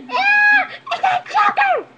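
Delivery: very high, loud squeal; excited shriek. Pretend. Revealing the joker card to viewers, high shriek. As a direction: shriek the reveal at the top of your range.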